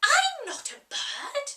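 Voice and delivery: put-on high, indignant girl's voice; Alice, offended. Alice protesting to the Sheep, high and indignant.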